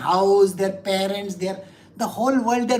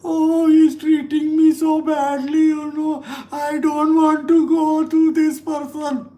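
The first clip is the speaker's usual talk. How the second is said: higher, louder, slow complaining whine; whiny complaint; 6 semitones higher, 6 dB louder, slower than usual. Retold or imitated. Hurt disciple whining about the guru, loud and slow. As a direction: whine like a wounded ego. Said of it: "you'll say".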